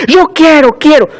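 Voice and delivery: put-on high-pitched childish demand; demanding little girl. Preacher imitates a little girl demanding things, childish and insistent. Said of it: "Como aquella niña".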